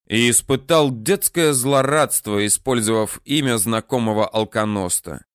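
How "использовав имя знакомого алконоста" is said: usual speaking voice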